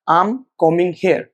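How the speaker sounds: "I am combing hair" slowly, as I'm com-ing hair; slow model "I am combing hair", contracted, B silent. Shown as a demonstration. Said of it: "will be contracted".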